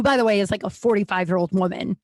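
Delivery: ordinary delivery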